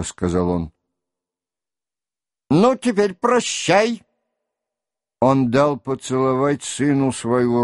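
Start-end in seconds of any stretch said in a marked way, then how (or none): 2.5-4.0 put-on higher-pitched old father's voice; lightly voiced old man saying goodbye; raised-pitch parting words; old prince's farewell. A narrator's reading. Old prince bids son farewell.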